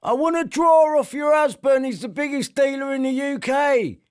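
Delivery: high put-on mocking voice. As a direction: take the piss in a silly high voice.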